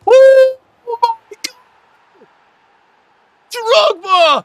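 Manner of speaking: loud, high outcry of disbelief; stunned shout. Real, not acted. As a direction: yell it at the screen. Stunned by opponent's goal.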